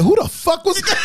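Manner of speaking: startled and laughing; shocked laughing yell. Retold or imitated. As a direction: burst out in disbelief. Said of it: "I was like".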